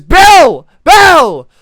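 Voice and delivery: high, very loud single-word yells; panicked scream. Acted. Shaken kid repeating his name, screaming in panic. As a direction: shriek the name as if being shaken.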